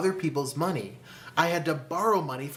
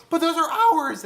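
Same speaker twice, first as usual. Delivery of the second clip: high, loud, pleading objection; hammed-up panicky protest in a raised pitch; mock protest; 13 semitones higher, 8 dB louder than usual. Couple refusing to give mentor their houses, mock panic.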